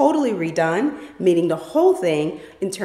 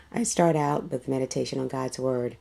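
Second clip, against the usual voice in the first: ordinary delivery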